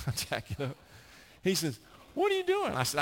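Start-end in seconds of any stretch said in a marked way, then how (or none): 2.1-2.7 mock dad, much higher and louder; hammed-up baffled father calling out; exasperated dad. Dad voiced in story.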